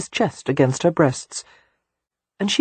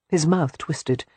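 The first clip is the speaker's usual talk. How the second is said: ordinary delivery, nothing marked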